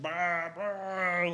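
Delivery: high mock grunts while flailing; imitating a flailing monster's grunts; blind monster grunts. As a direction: grunt like a blinded creature groping.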